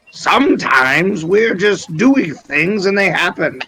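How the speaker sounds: put-on lilting wizard with an odd accent; weird-accented wizard voice. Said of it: "you speak in a weird accent".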